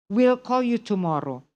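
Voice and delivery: normal talking voice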